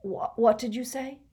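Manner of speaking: stammering hoarse shock. A narrator's reading. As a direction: croak it out in shock. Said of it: "said hoarsely".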